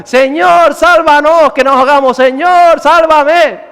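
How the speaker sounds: loud, high desperate shout; acted cry for help. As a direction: call out as if sinking. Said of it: "gritó".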